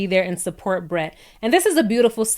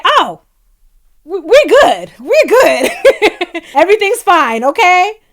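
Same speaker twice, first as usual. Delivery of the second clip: high, loud, fake-breezy "we good"; mock calm; 8 semitones higher, 8 dB louder than usual. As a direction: brush it off in a sing-song put-on voice. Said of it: "I was like".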